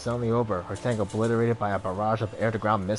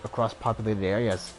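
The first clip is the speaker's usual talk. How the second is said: put-on Dublin accent. Ex-ranger tells a stranger her story, Dublin accent. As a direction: tell your past in a Dublin lilt. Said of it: "Born and raised in Dublin".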